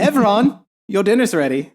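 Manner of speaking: high, loud mom calling out; calling kids to dinner. Pretend. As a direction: yell like a mother calling her kid home. Mother calling son to dinner.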